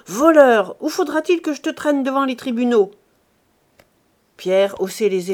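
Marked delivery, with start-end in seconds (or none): 0.1-2.9 much louder, fast raging accusation; furious shout. Acted. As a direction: hurl it at the thief. Antoine keeps shouting at Pierre.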